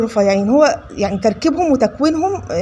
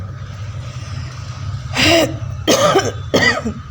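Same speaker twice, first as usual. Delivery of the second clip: soft breathy laugh; 5 semitones higher, 4 dB quieter than usual. Real, not acted. Laughs after a cheeky remark.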